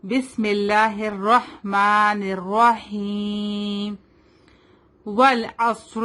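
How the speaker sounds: slow, drawn-out tajweed recitation. Shown as a demonstration. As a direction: model the basmala slowly for the pupil. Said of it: "So let's start". (آہستہ تلاوت)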